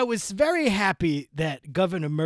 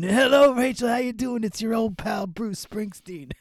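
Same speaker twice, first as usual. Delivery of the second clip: higher, chummy Jersey rock-star voice; 4 semitones higher than usual. Pretend. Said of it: "I'm in character. I'm doing a whole voice".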